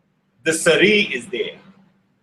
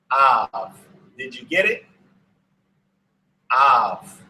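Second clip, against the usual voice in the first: ordinary talk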